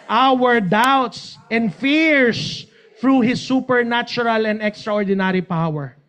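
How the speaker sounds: normal talking voice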